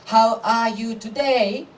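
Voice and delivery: slow, drawn-out classroom question, rising at the end; Japanese English teacher. Acted. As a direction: ask like a teacher drilling a class. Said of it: "a Japanese English teacher asks students".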